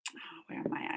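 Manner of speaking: whisper. Real, not acted. Searching for her place, hushed.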